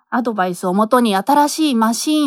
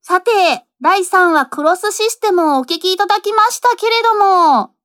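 high, projected show-host voice; radio host intro; 7 semitones higher, 4 dB louder than usual. Real, not acted. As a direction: present it brightly to the listeners. Host introduces talk after drama. (高く張った声で番組進行)

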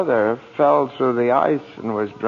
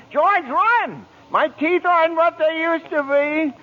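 panicked cries for help; 14 semitones higher than usual. Acted. Clarence biting the cop, calling for help.

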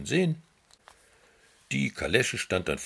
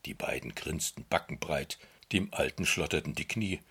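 usual speaking voice

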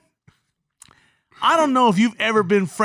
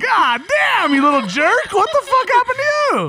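high, loud comic outburst; mock outrage; 12 semitones higher, 5 dB louder than usual. Uncle scolding his teasing niece, mock-furious yell. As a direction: yell in exaggerated indignation, pitch climbing.

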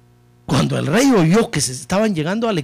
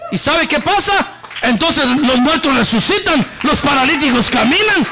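ecstatic preaching; 10 semitones higher, 4 dB louder than usual. Acted. Preacher exulting before the church, loud and high. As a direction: lift it into a shout of praise.

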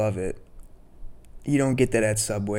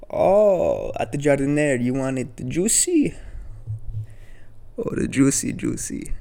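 higher, louder put-on old Italian voice savoring juicy; imitating old Italian deli man; 5 semitones higher, 4 dB louder than usual. Old deli owner offering juicy giardiniera, hammed-up imitation. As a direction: relish the word juicy like the old cashier. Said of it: "that's just how he talks".

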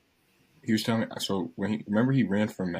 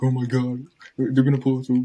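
louder, higher mock freak-out; imitated panic; 4 semitones higher, 7 dB louder than usual. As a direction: play the friend panicking over the cop car. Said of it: "Rohan starts freaking out".

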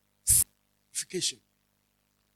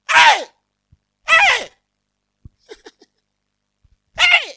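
high, loud shouted hey; shouting; 7 dB louder, much slower than usual. Shown as a demonstration. Preacher shows how shouting drowns out a partner. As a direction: yell it across the house. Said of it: "your shouting blurs the communication".

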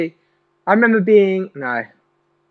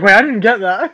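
higher-pitched mock assistant, laughing; mock Siri; 4 semitones higher than usual. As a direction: lightly imitate a confused voice assistant. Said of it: "He's like".

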